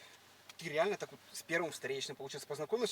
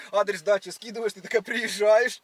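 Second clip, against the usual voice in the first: ordinary talk, nothing marked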